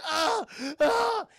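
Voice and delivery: high mock yelps of agony; cries of pain. Hagen's legs are being broken, high pained cries. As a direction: yelp twice as if hurt. Imitates man with breaking legs.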